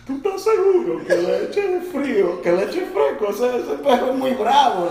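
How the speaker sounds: high-pitched, doubtful questioning; mock worried. Friend asks Chris about the fierce dog, mock nervous. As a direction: imitate a nervous friend seeking reassurance.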